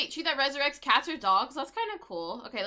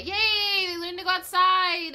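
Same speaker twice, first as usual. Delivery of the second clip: excited cheer; 7 semitones higher, 7 dB louder, slower than usual. Real, not acted. Pets learned potty training.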